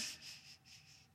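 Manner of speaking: whispered urgent shh; shushing. Acted. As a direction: hush him right away. Peter hushing Bud.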